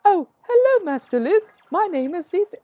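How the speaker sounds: exaggerated high C-3PO. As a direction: overdo C-3PO sky-high and fluting. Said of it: "I'm exaggerating".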